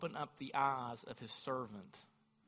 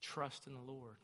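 hushed preaching; 6 dB quieter than usual. Acted. Preacher describing the angelic host, hushed awe. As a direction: draw them in quietly.

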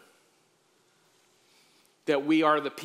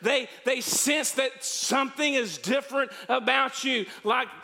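preaching at full force; 8 semitones higher than usual. Acted. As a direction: urge the congregation to live it. Closing exhortation at full force.

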